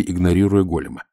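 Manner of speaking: plain reading voice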